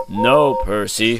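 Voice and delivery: big engine wheezing at the little one. A narrator's reading. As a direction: wheeze it out slowly. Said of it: "wheezed Gordon".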